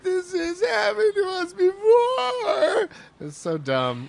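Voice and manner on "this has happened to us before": high, slow, wailing whine; whiny actor voice. Retold or imitated. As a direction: stretch it out in a crying wail. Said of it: "Tom Matthews starts to go".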